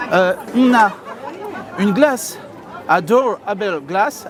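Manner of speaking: slow attempt at an English accent; French speaker putting on an English accent. Shown as a demonstration. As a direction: say it slowly in a self-mocked English accent. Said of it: "avec mon accent anglais incroyable".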